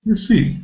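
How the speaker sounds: receipt with a weak first syllable and a high falling stress. Shown as a demonstration. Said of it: "the first syllable is weak".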